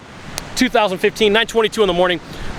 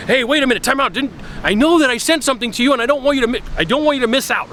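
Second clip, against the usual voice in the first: higher-pitched mock urgent plea; pleading pitch, raised voice; urgent sales appeal; 6 semitones higher than usual. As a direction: act out stopping a customer from missing out. Acted urgent second-offer letter to customer.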